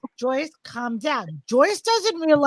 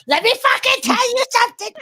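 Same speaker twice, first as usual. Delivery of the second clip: high mock yell with a pointed finger; imitated angry friend; 7 semitones higher than usual. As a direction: voice her angry and higher. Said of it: "She's like".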